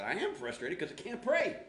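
high, exasperated retold complaint; frustrated. Pastor retelling answer to youth leader, frustrated. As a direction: protest it, pitch jumping up. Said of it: "you look kinda frustrated in prayer".

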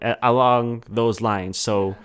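ordinary delivery

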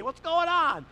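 loud, high, hearty call; booming phone greeting. Retold or imitated. Dad greeting a caller on speakerphone, loud and cheery.

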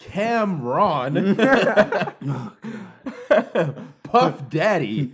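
drawn-out stiff reading of rapper names. Retold or imitated. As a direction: say the names slowly like reading off a list.

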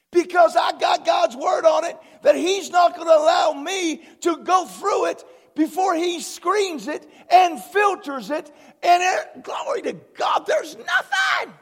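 loud sermon build, peaking high on the last words; preaching at full force. Acted. Pastor preaching God's protection, full force. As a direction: drive it home with conviction.

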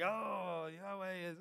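mock pirate accent on a drawn-out "Oh"; weird pirate accent. Youth pastor mimicking the sailors in a video, mock pirate accent. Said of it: "these weird accents in the video".

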